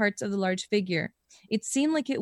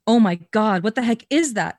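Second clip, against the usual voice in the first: louder exclaimed take, not a whisper; wrongly loud; 7 dB louder than usual. Shown as a demonstration. Reading a friend's line, loudly exclaimed by mistake. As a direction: blurt it out aloud instead of whispering. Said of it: "Okay, I did that wrong".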